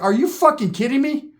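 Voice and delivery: high, stunned disbelief; incredulous. Retold or imitated. Storyteller stunned by cousin's plan, incredulous outburst. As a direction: blurt it out in shock.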